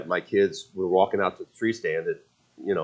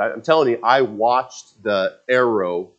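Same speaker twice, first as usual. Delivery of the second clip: ordinary talk, nothing marked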